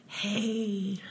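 soft breathy ghostly hey. Retold or imitated. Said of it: "this very breathy voice that went".

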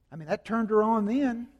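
ordinary talk

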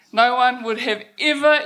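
normal talking voice

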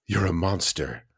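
soft, mock horrified accusation; mock accusing parent. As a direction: hush it like a shocked judgment. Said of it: "'cause it's like, gasps".

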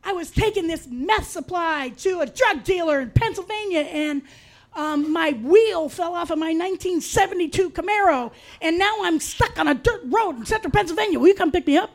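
high, breathless damsel-in-distress imitation; girlfriend's plea. Girlfriend calling at three a.m. with wild excuses, mock-frantic high voice. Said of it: "she would go stuff like".

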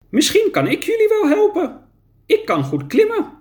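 a tiny creature asking kindly; small creature voice. Acted. Small creature offers help.

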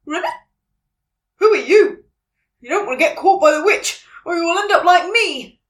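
high, loud frog character voice; croaking frog. A narrator's reading. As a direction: croak out an urgent warning. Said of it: "croaked a frog".

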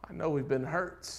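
usual speaking voice

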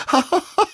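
high, loud gleeful laugh; long unpractised joyful laugh; hearty laugh. A narrator's reading. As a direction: laugh it out freely. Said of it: "He laughed and laughed".

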